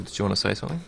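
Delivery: usual speaking voice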